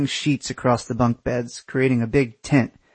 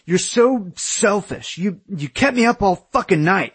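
whiny accusation; 8 semitones higher than usual. A narrator's reading. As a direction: accuse as the wronged party. Aaron accuses in quarrel.

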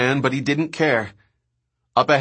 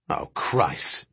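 low dismayed oath; 7 semitones lower, 6 dB quieter than usual. Acted. Driver sees tyrannosaur blocking the road.

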